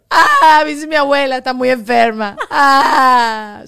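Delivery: loud, high, hammed-up sobbing; mock wailing. Friend competing over whose misery is worse, mock wailing. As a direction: wail theatrically to out-suffer everyone.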